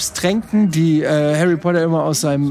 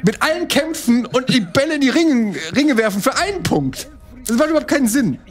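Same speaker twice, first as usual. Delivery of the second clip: higher, rising indignation; excited mock commentary; 6 semitones higher than usual. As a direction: build up the ranting commentary. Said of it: "den Trailer kommentieren".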